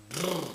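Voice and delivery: brrr as a loud buzzing trill; funny trill. Shown as a demonstration. Example of a funny trill for viewers, buzzing trilled brrr. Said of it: "other rather funny trills".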